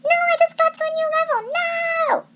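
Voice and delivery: high, loud whiny protest; shouted protest. A narrator's reading. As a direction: wail like a kid dragged off a game. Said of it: "as he shouted".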